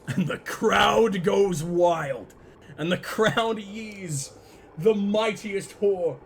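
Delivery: slow, loud put-on Southern announcer; mock announcer drawl. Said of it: "my accent".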